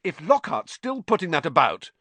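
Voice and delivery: loud, indignant outburst; heated. A narrator's reading. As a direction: snap it out indignantly. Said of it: "he said hotly".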